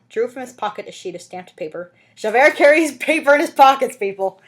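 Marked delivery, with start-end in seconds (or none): 2.2-4.4 loud, high mocking aside; incredulous aside. Real, not acted. Reader mocking the book to listeners, loud and high. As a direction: scoff at the detail.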